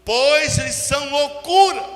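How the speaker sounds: loud, slow scripture declamation; forceful preaching. Real, not acted. Preacher quotes Corinthians to church, loud measured declamation. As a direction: drive each phrase out to the congregation.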